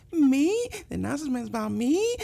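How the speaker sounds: high, breathy-free flirty lilt. Retold or imitated. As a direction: coo it like a flirt. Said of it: "Why she sounds so flirty?".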